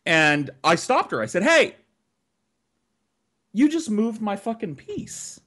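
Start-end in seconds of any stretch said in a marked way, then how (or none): 1.4-5.4 sharp high "Hey" then an indignant accusation; retold yell at the sister who moved his piece; angry accusation. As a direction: call her out for cheating, outraged. Confronting sister cheating.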